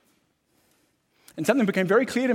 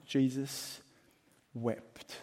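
hushed, pause between Jesus and wept; solemn pause; 3 semitones lower, 8 dB quieter, much slower than usual. Acted. Preacher reading the shortest verse, hushed and solemn. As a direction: let the verse land in a hush.

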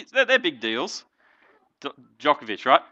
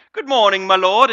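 louder, higher put-on greeting; mock greeting; 4 semitones higher, 10 dB louder than usual. Hailing a pregnant woman's belly as lord, mock loud greeting. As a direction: hail the bump in a raised voice.

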